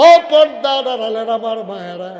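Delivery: very high, loud address to the crowd; full-force preaching. Real, not acted. Preacher calls thousands to listen closely, at full force. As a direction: shout your appeal across the gathering.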